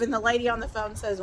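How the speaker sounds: ordinary delivery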